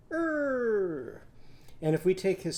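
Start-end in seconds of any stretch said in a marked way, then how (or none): 0.1-1.2 high drawn "Er"; Irving's er sound. Shown as a demonstration. Teacher answering her own question with a high "Er". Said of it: "what's Irving's sound".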